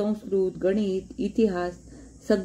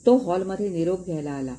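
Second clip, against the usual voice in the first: ordinary delivery, nothing marked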